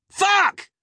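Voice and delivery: very high, loud startled shriek; dramatic fright. A narrator's reading. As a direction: shriek as if your heart nearly stopped. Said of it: "clutching his heart dramatically".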